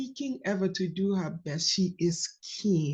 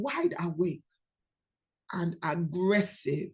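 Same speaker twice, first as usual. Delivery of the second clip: usual speaking voice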